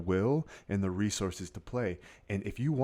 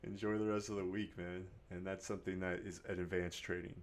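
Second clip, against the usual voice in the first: ordinary delivery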